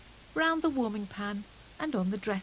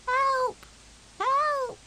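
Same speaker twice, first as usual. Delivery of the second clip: much higher, louder squeaky cry; tiny squeak; 6 dB louder than usual. A narrator's reading. Fuzzy Peg trapped in the snowball, squeaky cry. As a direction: squeak for help from inside the snow. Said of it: "squeaked a tiny voice".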